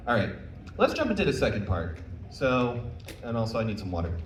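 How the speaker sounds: normal talking voice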